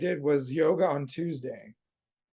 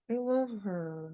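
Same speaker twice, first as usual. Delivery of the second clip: slightly drawn-out, softer gushing imitation; Donna; 3 semitones higher, 7 dB quieter, slower than usual. Co-host imitates Donna defending Sadness, lightly gushing. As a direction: lightly mimic someone adoring a character.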